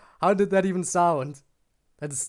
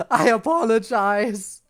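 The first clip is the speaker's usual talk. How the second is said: laughing; 3 semitones higher, 6 dB louder, slower than usual. Real, not acted. Helpless laughter after embarrassing take.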